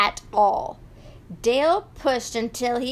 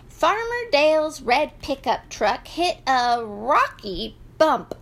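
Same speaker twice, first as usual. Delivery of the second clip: usual speaking voice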